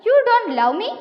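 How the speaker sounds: high, loud storybook retort; mock defiance as the wife; acted protest. Wife quarrels with crocodile. (ഉയർന്ന സ്വരത്തിൽ ഭാര്യയുടെ പരിഭവം അഭിനയിച്ച്)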